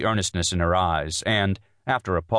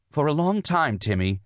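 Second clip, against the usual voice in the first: crying out; 9 semitones higher than usual. A narrator's reading. Wife fears being laughed out of it, crying out. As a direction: burst out, afraid he will laugh. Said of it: "she cried".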